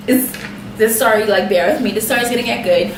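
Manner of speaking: normal talking voice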